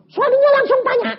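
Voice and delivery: high, loud, rushed exclamation; dramatic retelling. Retold or imitated. Preacher retelling the husband's sudden question, dramatic. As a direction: burst out with it fast and high.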